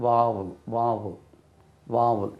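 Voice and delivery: வாவுல் as "VAA-vul", long open a; வாவுல் said like "vow-ul"; pronunciation model. Shown as a demonstration. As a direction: model வாவுல் in two falling syllables. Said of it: "உயிர் எழுத்துக்கு எப்படி?".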